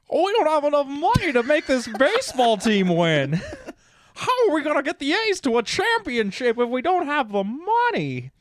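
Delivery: high, loud whining through laughter; laughing, mock-panicked team owner; Brad Pitt impression. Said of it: "my Brad Pitt".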